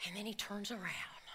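hushed unvoiced whisper. Real, not acted. Preacher whispering as the bear turns, hushed. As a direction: breathe it as if the bear might hear.

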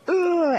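high pained groan of discomfort; a sharp, high imitated wince of pain; pained ugh. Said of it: "and you're like".

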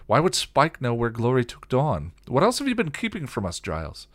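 suspicious, insistent demand. A narrator's reading. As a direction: challenge with climbing pitch. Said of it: "Buffy demanded".

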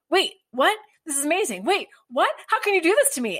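high, incredulous outburst; losing it, imitated. Co-host retelling a man's reaction to a first kiss, losing it. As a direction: act out being floored, voice jumping up. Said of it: "he loses his shit".

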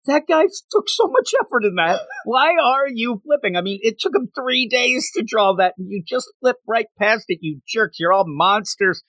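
high, whiny mock outrage at the reader; high-pitched hammed-up Deadpool complaining; indignant Deadpool. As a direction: scold the reader in a squeaky put-upon voice. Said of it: "and he's like".

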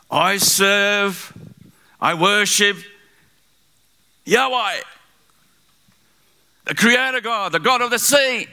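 high, ringing proclamation; emphatic declaration. Retold or imitated. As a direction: proclaim it as Jonah, phrase by phrase. Jonah answering sailors' interrogation.

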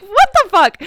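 high, loud disbelief; shocked outburst. Retold or imitated. Shocked as the booking fails again, high and loud. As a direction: blurt it out, stunned.

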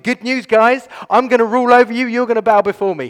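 high, loud gleeful boast; boy boasting to brothers, loud and thrilled; very excited. Retold or imitated. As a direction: brag to your brothers with glee. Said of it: "he tells them very excited".

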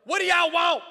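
loud, high mock-menacing yell. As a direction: yell like a stranger challenging intruders. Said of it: "and it yells".

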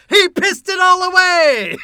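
high, drawn-out full-voiced shout; crowd yell. Man shouting to the crowd about his loss, loud yell. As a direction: holler it out slowly to a crowd. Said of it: "he yells to the crowd".

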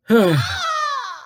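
high whiny sigh; whimpering sigh. Real, not acted. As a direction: let out a defeated whine. Defeated after failing.

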